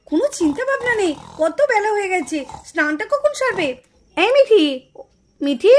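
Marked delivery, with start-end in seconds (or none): 4.2-4.6 loud call to wake her; mother waking her daughter, calling loud. Acted. 5.4-5.7 loud low call of her name; mother calling the sleeping girl, loud. Acted. Mother waking sleeping daughter.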